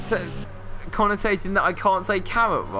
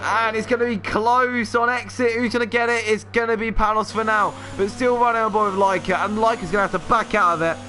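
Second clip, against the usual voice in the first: higher, fast-building play-by-play; excited race commentary; 5 semitones higher than usual. Acted. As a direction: call the overtake as it happens.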